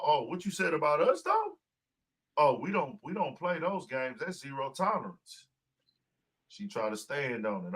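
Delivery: ordinary talk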